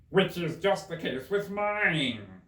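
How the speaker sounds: drawn out and loud like the Hatter. Acted. Hatter remarking on Alice's hair, slow and loud.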